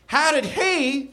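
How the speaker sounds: high, slow accusing retort; mock yell back. A narrator's reading. Narrator plays Tammy Faye questioning Jim, high mock yell. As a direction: pitch it high and stretch each word. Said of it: "yelled back Tammy Faye".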